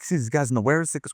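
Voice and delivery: usual speaking voice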